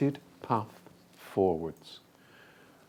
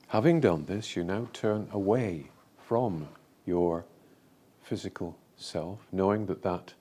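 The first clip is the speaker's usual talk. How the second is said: measured, lower meditation voice with long pauses; guided meditation; 6 semitones lower, 5 dB quieter than usual. Real, not acted. Speaker leading a group through a visualisation, guided meditation voice. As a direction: guide listeners through an imagined scene, phrase by phrase.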